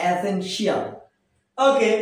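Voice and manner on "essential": "essential" said as "e-sen-shi-al"; common mispronunciation. Shown as a demonstration. Teacher warning learners off the wrong form, "essential" as e-sen-shi-al. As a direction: say "essential" with a full "shi-al" ending.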